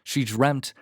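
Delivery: plain reading voice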